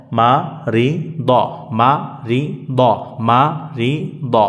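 slow, stretched three-syllable recitation; drawn-out rhythmic drill. Shown as a demonstration. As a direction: hold each syllable for pupils to copy. Teacher repeats syllable drill.

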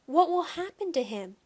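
high, anxious question; worried cry. A narrator's reading. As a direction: ask it fearing the worst. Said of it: "Shyla cried".

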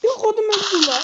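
high, loud startled cry; loud outburst. Real, not acted. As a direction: blurt it out in shock. Sudden outburst while playing.

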